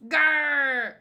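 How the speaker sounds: fierce growl; angry growl. Acted. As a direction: growl like a beast. Voicing anime fight along.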